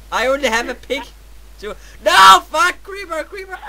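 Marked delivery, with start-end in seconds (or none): 2.0-3.6 high-pitched panic at a sudden threat; startled gamer panic; panicked yelp. Real, not acted. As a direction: yelp out in alarm, loud at first then thinning. Sudden creeper attack in game.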